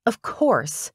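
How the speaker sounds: scoffing, pitch swooping high; snorted retort. A narrator's reading. Assistant answering a doubt about her walking, scoffing. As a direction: snort it as if obvious. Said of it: "I snorted".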